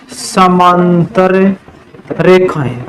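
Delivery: drawn out, word by word; slow dictation. Real, not acted. Teacher writing out the term for the class, slow dictation. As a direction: stretch each word as you write it.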